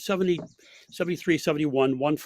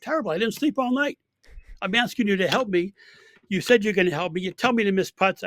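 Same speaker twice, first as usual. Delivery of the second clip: tired complaining player; 6 semitones higher, 3 dB louder than usual. Retold or imitated. Tired upset player quoted.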